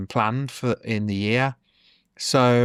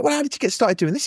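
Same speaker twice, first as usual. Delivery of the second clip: mock astonished, much higher and quick; amazed question; 13 semitones higher, much faster than usual. Retelling the comedian's stunned question, in a high, quick, amazed voice. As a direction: squeak it out in disbelief. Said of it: "It was like".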